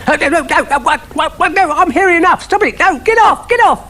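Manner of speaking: dog squealing as he's doused; frantic protest. Acted. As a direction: fend them off in a panic. Dougal protests being doused.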